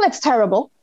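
usual speaking voice

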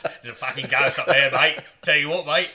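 mock Australian woman yelling to leave; hammed-up Australian shout; loud Australian accent. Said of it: "She just".

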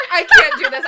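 loud high cry of defeat; exasperated outburst. Real, not acted. Reader refusing to read cringe fiction aloud, loud outburst. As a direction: give up loudly mid-read. Breaks down laughing before reading.

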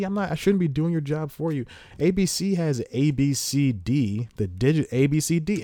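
usual speaking voice

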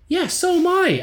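high-pitched indignant comeback; retold irritated retort. Teller answering the annoying drummer at the gig, indignant.

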